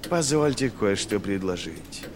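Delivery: slow, oily offer of advice; disrespectful politeness. A narrator's reading. General offers the lord marshal a suggestion, veiled disdain. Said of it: "демонстрируя своим тоном обратное".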